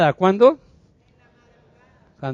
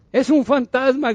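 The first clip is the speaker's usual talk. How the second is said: high-pitched retold cry of fear; mock terrified exclamation; frightened cry; 7 semitones higher than usual. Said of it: "gritaron de miedo".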